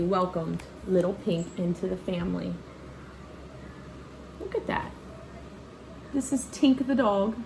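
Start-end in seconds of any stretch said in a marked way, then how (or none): none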